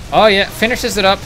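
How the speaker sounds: loud, high hype; excited call. Real, not acted. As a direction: cheer the finish. Caster reacts to kill.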